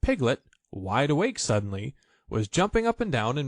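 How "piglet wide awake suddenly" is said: usual speaking voice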